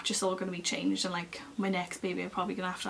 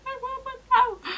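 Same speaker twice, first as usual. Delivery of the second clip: very high wailing demand; retold screaming; 6 dB louder than usual. Woman in labour demanding an epidural from midwife, mock screaming. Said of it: "I was screaming in her face".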